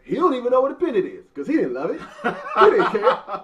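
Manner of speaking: high, loud, fast mock outrage; indignant high rant. Real, not acted. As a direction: fire it off in laughing indignation.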